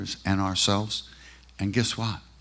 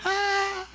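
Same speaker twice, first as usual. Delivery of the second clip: imitated exclamation. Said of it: "he looks and he goes".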